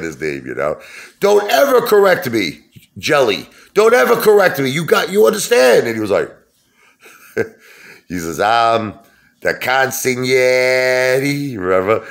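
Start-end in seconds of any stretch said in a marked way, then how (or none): 1.2-5.9 high, loud mob-boss yell; De Niro mobster yell. Retold or imitated. De Niro's character scolding the guy who corrected him, high and loud. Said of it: "he couldn't say it right". 8.4-11.6 very slow, drawn-out Italian-style consigliere; mock Italian accent. Billy Crystal's character trying to sound Italian, in a slow put-on accent. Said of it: "trying so hard to talk, you know, Italian".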